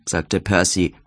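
ordinary delivery

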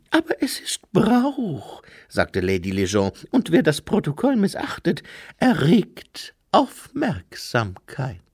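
Lady Lejeune's voice. Acted. To Herr Weiss, a high airy lady insisting on custom.

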